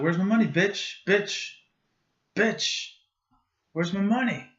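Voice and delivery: high-pitched imitation of a whiny demand; Jesse Pinkman. Teaching Bradley to say bitch, high-pitched character imitation. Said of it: "Jesse Pinkman".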